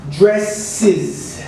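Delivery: "dresses" with a clear iz ending; "dresses", dre-siz, falling; extra syllable. Shown as a demonstration. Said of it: "pronunciation changes with an extra syllable".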